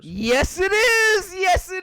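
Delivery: yelling yes, high and loud; excited shout. Real, not acted. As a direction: shout with joy at the right answer. Guess is right, shouts.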